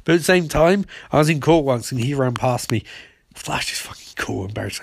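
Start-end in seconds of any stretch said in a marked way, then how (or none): 3.3-4.4 whispered gushing. Retold or imitated. Fan gushing in court.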